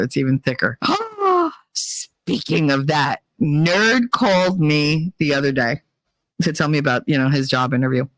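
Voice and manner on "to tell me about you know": normal talking voice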